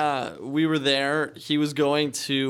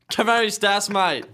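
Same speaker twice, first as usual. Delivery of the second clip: put-on laughing, Spanish with an Australian accent; 4 semitones higher than usual. Repeating the greeting for the amused co-host, Australian accent. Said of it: "speak Spanish with an Australian accent".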